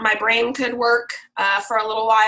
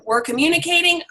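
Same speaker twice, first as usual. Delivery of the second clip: ordinary talk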